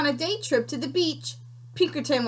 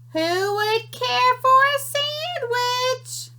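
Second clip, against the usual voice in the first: high, slow sing-song call from the distance; faint far-off call; 12 semitones higher, slower than usual. Acted. Unseen creature calling to the scouts, high and slow. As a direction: lure them in from far away.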